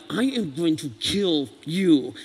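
slow, deliberate and cold; measured, chilling menace; slow menacing threat. Shown as a demonstration. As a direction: spell out each word like a real threat. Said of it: "that's another tone".

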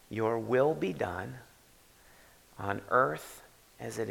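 slow, deliberate with long pauses between phrases; slow reading. Shown as a demonstration. Pastor reading the Lord's Prayer to the church, slowly. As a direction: read each phrase slowly, letting it sink in. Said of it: "I'm gonna read it slowly".

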